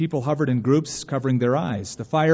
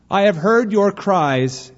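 loud, slow, higher proclamation; announcing stranger; 6 semitones higher, 6 dB louder, slower than usual. A narrator's reading. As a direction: announce it slowly and solemnly to all. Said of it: "it announced".

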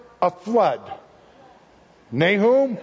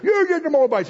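higher, eager cheer of the crowd; mock crowd cheer; 9 semitones higher than usual. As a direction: cheer on the punishment like a rowdy crowd. Crowd cheering God's judgment.

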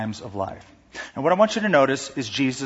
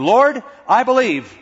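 high, loud, slow cry; desperate father; 8 semitones higher, 6 dB louder than usual. Retold or imitated. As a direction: call out in need.

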